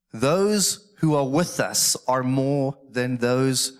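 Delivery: very slow, weighty declaration; scripture proclamation. Real, not acted. Prophet's answer read to the congregation, slow proclamation. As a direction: proclaim it deliberately, settling at the end.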